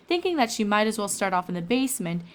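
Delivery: plain reading voice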